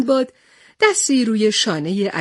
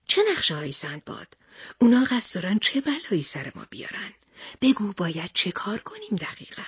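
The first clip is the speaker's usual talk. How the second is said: hushed, husky character questioning; Pahlevan Panbeh's voice; 6 dB quieter than usual. Acted. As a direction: drop the volume and press for answers. Pahlevan Panbeh stands up, asks.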